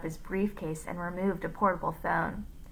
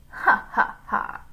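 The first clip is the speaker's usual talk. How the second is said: loud, spaced "ha, ha, ha"; hammed-up mocking cackle; villain's laugh; 3 semitones higher, 5 dB louder than usual. A narrator's reading. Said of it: "Mister Gorf laughed again".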